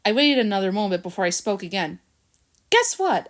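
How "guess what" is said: eager. A narrator's reading. Eager question to Chester.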